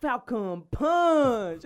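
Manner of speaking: slow, hammed-up game announcer yell; Falcon Punch shout. Friends joking about Smash Bros, hammed-up battle cry. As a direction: stretch it out like a fighting game move.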